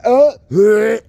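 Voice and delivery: high loud startled cry; startled yelp. Real, not acted. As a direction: jump at the sight. Startled by cockroaches.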